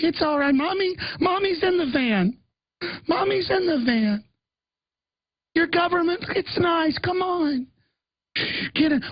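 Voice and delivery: sweet coaxing stranger. Pretend. Stranger coaxing child into the van, sugary high. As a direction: coax the kid with fake sweetness.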